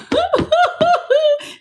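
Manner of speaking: a quick high-pitched laugh. Real, not acted. Greeting viewers in the live chat, high laugh. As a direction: burst into a light, high laugh.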